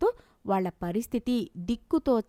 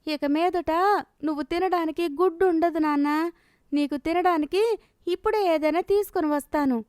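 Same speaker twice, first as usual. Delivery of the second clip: lightly acted mother, pitched higher; a raised, even voice explaining to a child; mother consoling son; 6 semitones higher than usual. Mother promising food to her hungry son, consoling.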